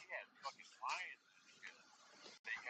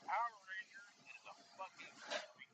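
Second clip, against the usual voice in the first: ordinary delivery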